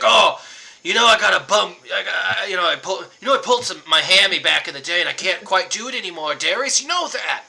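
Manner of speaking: loud, high aging jock begging off; hammed-up washed-up athlete whining loud; Chip's excuse. Game master voices Chip to Darius, loud and high.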